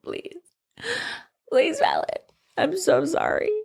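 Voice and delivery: mock tearful plea, pitched high; mock crying. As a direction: imitate someone begging through tears. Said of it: "He, like, starts crying and shit".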